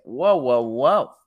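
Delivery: loud "whoa"s in mock alarm; taken-aback protest. Student protesting to the teacher's assistant, taken aback. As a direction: burst out loudly to halt them.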